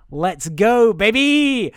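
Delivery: loud, rising rallying cry; hammed-up triumphant yell; mock hype shout. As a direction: holler it, high and drawn out on the last word. Mock rallying cry ending the speech.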